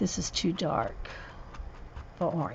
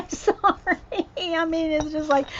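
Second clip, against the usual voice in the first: laughing; 9 semitones higher than usual. Real, not acted. Apologetic laugh to viewers.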